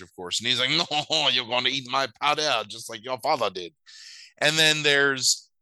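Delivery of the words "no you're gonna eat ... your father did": lightly voiced boastful rival; Jean-Georges. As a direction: taunt like a racing villain. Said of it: "one of them is Jean-Georges".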